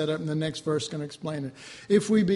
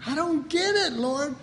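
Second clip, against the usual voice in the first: higher, helpless complaint; baffled plea to God; 8 semitones higher than usual. Retold or imitated. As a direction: plead to the Lord, confused. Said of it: "I go".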